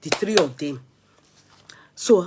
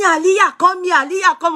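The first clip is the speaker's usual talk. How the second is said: calling the dog; 11 semitones higher, 7 dB louder than usual. Retold or imitated. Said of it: "Ní mommy mi ní".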